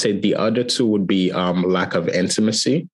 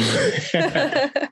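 laugh. Real, not acted. Guest laughs at the question.

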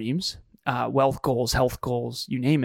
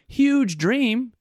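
emphatic; 10 semitones higher, 4 dB louder than usual. Real, not acted. Stressing the huge dream.

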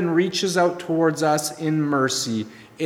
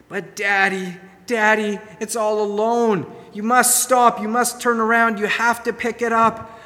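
put-on high, excited kids' voice begging Daddy; pleading children; 6 semitones higher than usual. Children in the back seat begging Daddy to stop the car, high and pleading. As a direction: play a child tugging at Dad to stop.

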